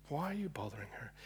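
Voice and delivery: soft, quick whisper; whispered question. Acted. Jesus quietly rebuking the guests, whispered. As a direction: hush it as a tender rebuke.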